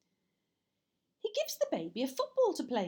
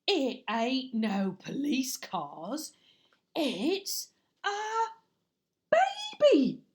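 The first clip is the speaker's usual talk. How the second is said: put-on slow, then climbing much higher and louder on baby; astonished burglar; 3 semitones higher, 8 dB louder, much slower than usual. Burglar discovering a baby in the box, rising shock. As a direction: build up to a startled cry.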